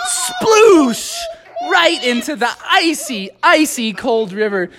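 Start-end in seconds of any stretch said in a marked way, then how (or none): 0.1-1.2 high, drawn-out sploosh sound effect; splash noise. Acted. Storyteller voicing Smee hitting the water, high splash noise. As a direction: make a big vocal splash.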